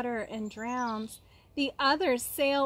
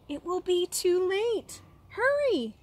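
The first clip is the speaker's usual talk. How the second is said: high, urgent call to hurry; urgent urging; 8 semitones higher than usual. A narrator's reading. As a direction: cheer the little seed on, pressing. Narrator urging the seed to grow.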